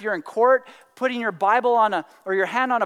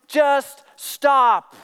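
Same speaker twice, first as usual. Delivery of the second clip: louder, higher, punched words; emphatic acted plea to quit; exasperated command; 4 semitones higher, 6 dB louder than usual. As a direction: lay down the law, fed up. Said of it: "That's how he said it".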